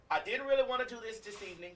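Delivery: normal talking voice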